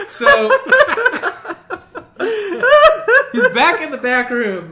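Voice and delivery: high, loud laughter through words; laughing hard. Real, not acted. Teller cracking up over Danny in the back room, laughing hard. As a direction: laugh while telling it.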